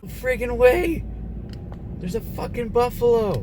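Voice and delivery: high, loud burst of amazement; high-pitched, thrilled holler; excited yelling. Retold or imitated. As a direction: yell it out in giddy disbelief. Reacting to sighting a buffalo.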